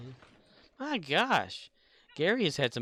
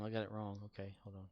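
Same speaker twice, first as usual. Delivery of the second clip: whispering; 5 semitones lower, 11 dB quieter, much faster than usual. Real, not acted. Mistake while editing sounds.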